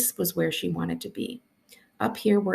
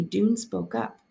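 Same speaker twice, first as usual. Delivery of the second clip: usual speaking voice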